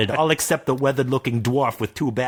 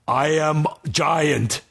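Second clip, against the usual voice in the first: very slow dim giant voice; 5 semitones higher, much slower than usual. Acted. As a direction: plod each word like a dumb giant. Giant speaks.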